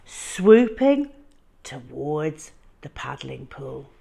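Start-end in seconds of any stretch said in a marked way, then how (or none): none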